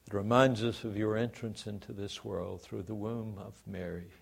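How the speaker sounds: soft, hushed prayer fading out; quiet closing prayer, trailing away; pastoral prayer. Real, not acted. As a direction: pray humbly for the congregation. Pastor leads congregation in prayer.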